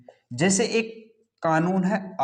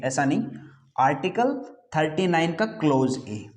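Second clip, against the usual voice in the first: ordinary talk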